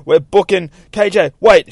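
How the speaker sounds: high, loud call after a runner; urgent calling. Acted. Girls running from ninjas, urgent high call. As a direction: shout to halt a friend.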